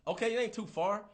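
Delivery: usual speaking voice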